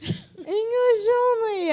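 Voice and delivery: high, slow whiny wail; mock despair. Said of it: "cry heard, mock despair".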